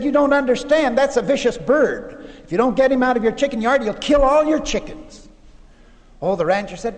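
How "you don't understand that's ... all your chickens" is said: higher, emphatic acted alarm; urgent warning. Salesman warns rancher about the vicious bird, urgently. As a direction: press the danger on an unconcerned listener, in a retold quote.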